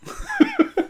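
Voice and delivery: squeaky burst of laughter; high squeal laugh. Real, not acted. Laughing at the finance channel bit, high squeal. Laughs after the mock quote.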